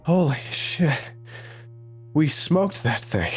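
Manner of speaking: panting between words; breathless after running; out of breath. Acted. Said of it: "still panting".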